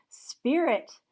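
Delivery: loud, high cry to the spirit; desperate cry. Acted. Scrooge begging the spirit, desperate loud cry. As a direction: call out to the spirit in terror. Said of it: "Scrooge cried".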